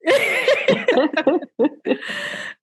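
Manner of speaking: a long, high, delighted laugh. Real, not acted. Laughs at thanks.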